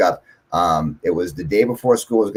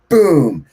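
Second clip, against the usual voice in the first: loud, higher-pitched boom; imitated boom; 8 semitones higher, 6 dB louder than usual. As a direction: burst out with the lightning's boom. Imitating the lightning strike's sound.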